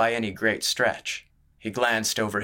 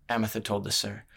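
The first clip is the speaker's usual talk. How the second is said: usual speaking voice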